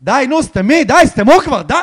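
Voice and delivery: high, loud exasperated shouting; imitated yelling. Imitating three girls telling others to shut up, shouted. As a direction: yell it like a fed-up heckler, high. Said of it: "אתה מכיר את זה שאני צועקות".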